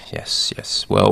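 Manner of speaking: ordinary delivery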